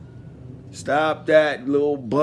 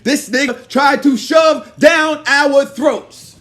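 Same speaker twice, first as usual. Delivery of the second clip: slow, furious shout; angry shout; 5 semitones higher, 7 dB louder, slower than usual. Real, not acted. Ranting at Joe, slow furious shout. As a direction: yell it out slowly in real anger.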